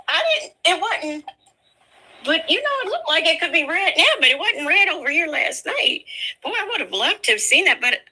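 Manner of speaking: high, fast talk through giggles; laughing. Real, not acted. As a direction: chuckle your way through it. Laughing about missing red moon.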